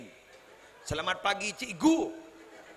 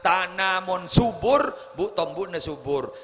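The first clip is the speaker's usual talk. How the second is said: louder, higher preaching to the congregation; full-force preaching; 4 semitones higher, 9 dB louder than usual. Acted. Preacher urging mothers to join Muslimatan, full preaching voice. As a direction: drive the point home to the mothers. Preaching good-soil image.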